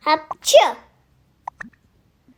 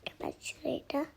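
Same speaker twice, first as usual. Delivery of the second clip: whispering; 12 dB quieter, faster than usual. Real, not acted. Whispering aside off camera.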